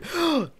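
sudden high intake of breath; startled, high-pitched gasp; sharp gasp. Real, not acted. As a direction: gasp in real shock. Sudden death in the game.

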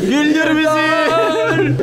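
drawn-out teasing plea. Real, not acted. Friend teasing the comedian backstage, high and drawn out. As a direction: tease the comic by dragging out the words. Begging performer to be funny.

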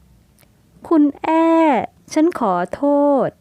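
slow, high voice holding back tears; tearful apology. A narrator's reading. Jaravee apologizing to Aei from hospital bed, tearful. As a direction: apologize through tears, haltingly. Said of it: "จาราวีพูดออกมาทั้งน้ำตา".

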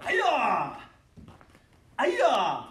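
loud sharp fighting yell; repeated karate yell on command; kiai shout. Shown as a demonstration. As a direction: bark the kiai out hard.

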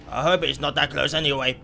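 ordinary delivery

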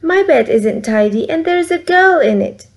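little bear voice jumping up at the end; Baby Bear. A narrator's reading. Baby Bear finds a girl in his bed, rising excited. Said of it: "says Baby Bear".

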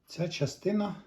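ordinary talk, nothing marked

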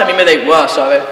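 put-on low, swaggering boyfriend voice; a hint of a macho boyfriend showing off; mock cocky boyfriend.

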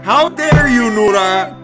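usual speaking voice

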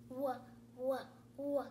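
"Wha" as a short rounded "wuh"; phonics W sound. Shown as a demonstration.

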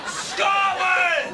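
defiant shout. Acted. Trapped Scotsman joining his friend's chant, defiant shout. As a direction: bellow it as a rallying cry.

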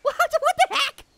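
high, amazed laugh; a surprised laugh; laughing surprise. Real, not acted. Headless zombie appears.

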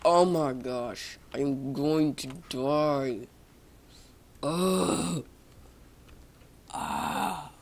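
loud, slow, drawn-out dread; mock doom. Player about to die in game, loud drawn-out dread. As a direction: groan it out slowly as if doomed.

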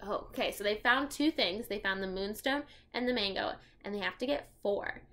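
ordinary delivery

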